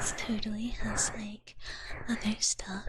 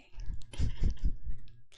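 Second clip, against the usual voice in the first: whispered giggle; 5 dB louder than usual. Real, not acted.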